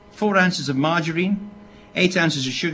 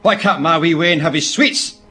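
loud, indignant Scottish mother; broad Scottish accent; 2 semitones higher, 7 dB louder than usual. Retold or imitated. Mother confronting the village shop woman, broad Scottish accent. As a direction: demand it loudly in broad Scots. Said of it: "in her broadest Scottish accent".

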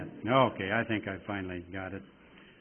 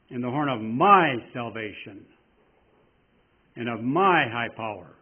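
"my" leaned on each time, much louder; stress on "my"; 6 dB louder than usual. Shown as a demonstration. Preacher making each listener the "my", loud and weighted. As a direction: push the word "my" in every line. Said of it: "putting the emphasis on these words".

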